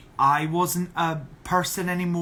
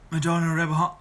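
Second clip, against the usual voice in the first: ordinary talk, nothing marked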